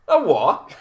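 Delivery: exaggerated Bristol incomprehension; mock bafflement. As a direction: ask it loudly, as if totally lost. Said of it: "And they were like".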